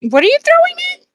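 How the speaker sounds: sudden high, fast disbelief; startled high question; surprised squeak. Real, not acted. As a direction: jump way up in pitch, quick. Surprised at the evil sticker.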